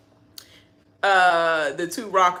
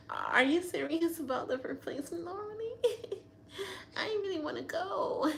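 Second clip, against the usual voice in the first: higher, giggling, a bit softer; laughing mock plea; 12 semitones higher, 5 dB quieter than usual. Vlogger retells Taylor asking about the nominee, laughing plea. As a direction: beg through the laughter, pitched up. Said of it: "She was like".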